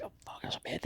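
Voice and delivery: whispered to himself in alarm; breathy whispered realisation at a funeral; whispered thought. Retold or imitated. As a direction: hush it like a panicked inner thought. Realising in his head at funeral.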